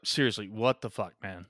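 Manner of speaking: ordinary talk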